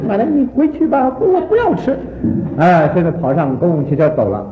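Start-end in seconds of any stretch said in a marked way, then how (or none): 0.1-2.0 put-on much higher, boyish pleading voice; embarrassed grandson. Grandson at the bus stop begging grandma, higher boyish pleading. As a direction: beg grandma to go home. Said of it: "说".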